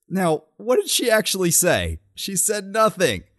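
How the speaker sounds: sarcastic. Real, not acted. Host mocking the speech to his cohost, sarcastic. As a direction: scoff at the empty line. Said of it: "sarcastic reveal, much higher".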